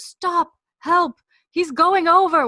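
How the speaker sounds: high, loud mock cry for help; alarmed shout. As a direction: call out in acted alarm. Claire calls out as Jamie falls.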